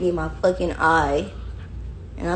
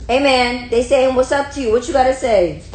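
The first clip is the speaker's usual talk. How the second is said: loud, high-pitched demand to a pet; excited high talk to a dog; high puppy voice; 5 semitones higher, 6 dB louder than usual. Pretend. Repeats higher, louder to dog.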